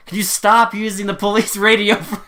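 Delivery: loud, high imitation of an irate cop; mock exasperated yell. Policeman scolding detective over radio chats, mock exasperated yell. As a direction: yell it as a fed-up colleague, hammed-up. Policeman telling him off, retold.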